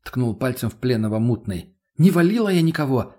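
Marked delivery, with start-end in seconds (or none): 2.0-3.2 higher, emphatic protest; wide-eyed outraged denial; indignant denial. A narrator's reading. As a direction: deny it as if falsely accused. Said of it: "округлила глаза девушка".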